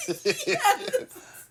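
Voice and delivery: high laugh through the word; giggling out a yes. Real, not acted. Laughing at the howling joke.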